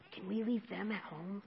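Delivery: ordinary talk, nothing marked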